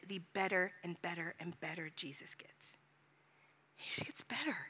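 soft whisper of wonder. Real, not acted. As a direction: let it fall to a whisper. Tender aside about Jesus.

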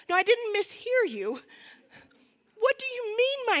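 high, loud, incredulous protest; indignant disbelief. Retold or imitated. Challenging friend about her face, incredulous indignation. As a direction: push back, voice climbing in mock outrage.

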